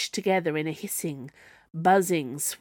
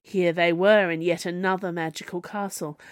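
ordinary delivery

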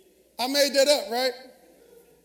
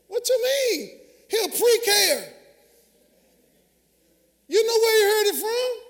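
sassy parent retort; 9 semitones higher, 5 dB louder than usual. Retold or imitated. Mock parent retorting to teacher.

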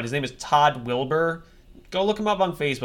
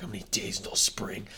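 grumbling whisper; 7 semitones lower, 9 dB quieter, faster than usual. Retold or imitated. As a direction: mutter it under breath. Quoting grumpy winter self.

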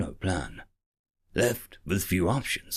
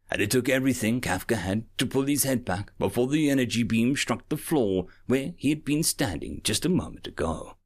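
ordinary delivery

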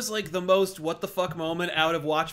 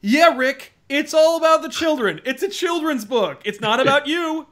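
loud mocking retort; 9 semitones higher, 8 dB louder than usual. Mocking Rickman's line.

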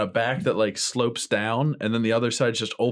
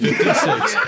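laughing; 4 dB louder than usual. Real, not acted. Joking answer, laughing high.

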